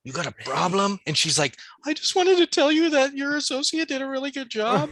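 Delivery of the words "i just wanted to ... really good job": much higher, thin put-on customer voice; mock timid customer. Customer to the manager, in a high mock-timid voice. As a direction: play a nervous customer squeaking out praise.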